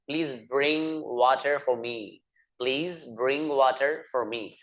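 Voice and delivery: very slow, every word spelled out for learners; slow model sentence. Shown as a demonstration. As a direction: say it slowly so students can repeat. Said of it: "teacher models English sentence". (बहुत धीरे, साफ़ बोलकर)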